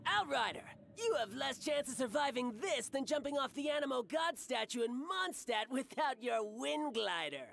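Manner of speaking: ordinary talk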